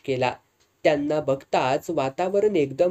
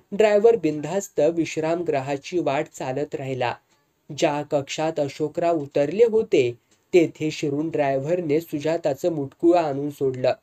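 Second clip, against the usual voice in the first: ordinary delivery, nothing marked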